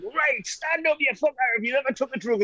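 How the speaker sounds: high, brash challenge to the room; mock Geordie bloke picking on a cop; rowdy Geordie bloke. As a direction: call someone out like a cheeky bloke. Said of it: "potty fucking mouth, Geordie bloke".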